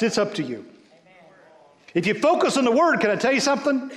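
high, loud, driving sermon voice; preaching at full force. Acted. Pastor urging the congregation to focus, full-force preaching. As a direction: preach it out hard to the room.

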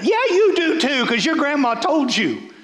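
high, emphatic comeback; playful insistent contradiction; mock scolding retort. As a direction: jump up in pitch to contradict the doubter. Preacher retorts to imagined doubter.

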